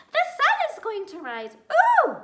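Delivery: high, loud piping squirrel voice; excited squeak of a small animal; piping little squirrel. A narrator's reading. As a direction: pipe it up like a tiny eager squirrel. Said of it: "piped up".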